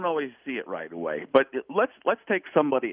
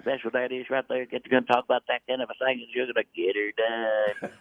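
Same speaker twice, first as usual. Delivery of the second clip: Larry the Cable Guy. Retold or imitated. Slipping straight into Larry the Cable Guy, Southern accent. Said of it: "straight Larry the Cable Guy".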